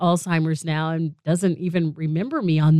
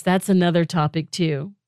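ordinary talk, nothing marked

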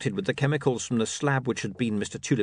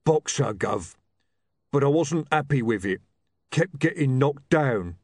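big simple bruiser, higher at the end; humble ex-boxer; 6 semitones higher, 4 dB louder than usual. Acted. Ex-boxer explaining his last job, simple bruiser voice. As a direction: admit defeat like an honest old fighter. Rocky's figure voice.

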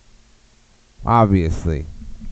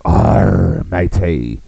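put-on a light pirate accent on a girl, a touch louder; 6 dB louder, slower than usual. Comic voicing a pirate woman calling him over, pirate accent. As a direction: growl it like a lady pirate. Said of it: "do the pirate and the girl voice".

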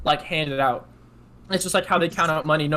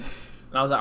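ordinary delivery

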